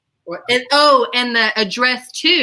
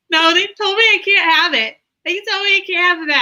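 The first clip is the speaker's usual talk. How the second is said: higher and louder, then fast; exasperated retelling; 9 semitones higher, 4 dB louder, faster than usual. Retold or imitated. Student telling the teacher the FBI kept it, higher and louder. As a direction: protest to the teacher. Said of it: "I'm like".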